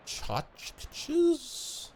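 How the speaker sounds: high squeak on tchotchkes; playful high tchotchkes; squeaky silly. Acted. Silly word emphasis.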